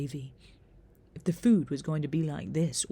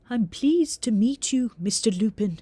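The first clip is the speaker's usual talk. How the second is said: higher, louder reedy Scottish teacher; reedy Scottish accent; 5 semitones higher, 7 dB louder than usual. A narrator's reading. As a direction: greet formally in a reedy Scottish voice. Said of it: "the teacher said in a reedy Scottish accent".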